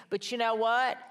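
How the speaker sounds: ordinary talk, nothing marked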